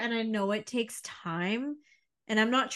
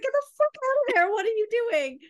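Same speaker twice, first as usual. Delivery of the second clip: high, loud, fast mock yell; exasperated yell; 13 semitones higher, 5 dB louder, faster than usual. To her friend stuck in a bad job, loud exasperated mock yell. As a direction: shout it at a friend who won't leave. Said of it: "And I'm just like".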